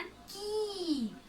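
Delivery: "की" with a long, high, held ee; forceful, stretched vowel. Shown as a demonstration. Teacher showing pupils the sound with the long vowel sign, forcefully stretched. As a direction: push and draw out the ee in "की". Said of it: "जोर लगाकर खींचकर".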